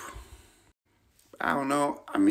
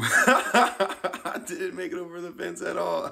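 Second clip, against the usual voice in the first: slow, higher words through laughter; laughing; 9 semitones higher, slower than usual. Real, not acted. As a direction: giggle through the admission. Laughing at his fail.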